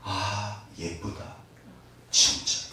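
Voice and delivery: father gazing at his son, hushed; tender father's whisper. Retold or imitated. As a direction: marvel quietly at a newborn. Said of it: "아버지가 딱 그러셨대요".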